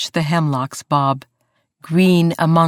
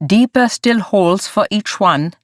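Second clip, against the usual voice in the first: lightly voiced German foreman; clipped German accent, called out; 4 semitones higher, 4 dB louder than usual. A narrator's reading. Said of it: "His accent became more pronounced".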